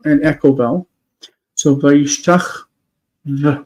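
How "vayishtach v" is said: slow "vayishtach", pause, then a lone "v"; "vayishtach v" drawn out, final consonant detached; dangling final v. Shown as a demonstration. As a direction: leave the v of "vayishtach v" hanging by itself. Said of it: "with a vav just hanging out there".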